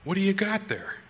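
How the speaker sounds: a touch of a cheerful stranger; Titus asking friendly. Retold or imitated. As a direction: lightly voice a young man greeting a kid. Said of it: "he says".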